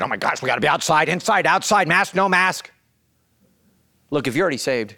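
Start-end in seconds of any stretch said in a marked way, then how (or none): 0.0-2.7 fast, higher exasperated whine; annoyed member griping about masks; mock irritated churchgoer. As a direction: complain breathlessly about the rules. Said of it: "you're all irritated".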